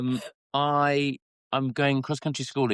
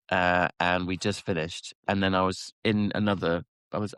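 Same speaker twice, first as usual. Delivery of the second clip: ordinary delivery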